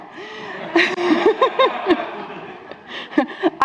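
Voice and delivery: long, high-pitched real laugh; amused laugh. Real, not acted. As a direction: laugh at your own slip, drawn out. Laughs at own slide mistake.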